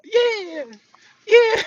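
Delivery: very high mock squeal; drawn-out falsetto cheer, imitating her; excited girl impression. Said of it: "she do talk like that".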